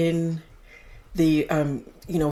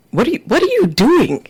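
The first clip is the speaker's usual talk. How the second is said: incredulous; 6 semitones higher, 6 dB louder than usual. Real, not acted. Baffled at cohost's promo voice.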